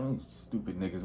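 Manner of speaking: low, very soft muttered aside; imitated under-breath remark. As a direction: re-enact grumbling under your breath. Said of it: "I try to say it under my breath".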